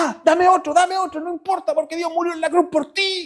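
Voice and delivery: high, pressing voice of a man being whipped; defiant preacher. Retold or imitated. Preacher being whipped answers his attacker, high and fervent. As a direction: plead back fervently, take the blows.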